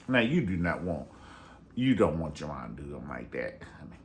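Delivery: ordinary talk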